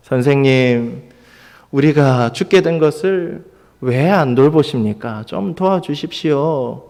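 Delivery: far too mild appeal for help; meek, polite plea. Shown as a demonstration. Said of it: "뭐 이렇게 말했을까요? 예, 그렇지 않습니다".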